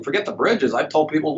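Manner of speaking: ordinary talk, nothing marked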